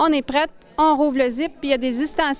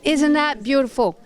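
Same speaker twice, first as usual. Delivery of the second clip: ordinary talk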